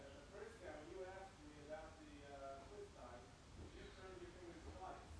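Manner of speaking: distant and muffled, as if across the room; talking away from a faraway laptop mic; faint distant voice on a poor connection; far-off mic. Real, not acted. Screen fails, moves to other Wi-Fi.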